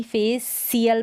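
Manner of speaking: "फेसियल" with plain s mid-word; wrong s pronunciation. Shown as a demonstration. Teacher rejects a misreading of facial, wrong s pronunciation. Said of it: "ना ही".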